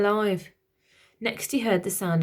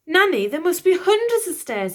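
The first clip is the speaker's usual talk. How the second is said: put-on high, louder boy's complaint; boy protesting; 9 semitones higher, 7 dB louder than usual. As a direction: whine like a put-upon prince. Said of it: "he protested".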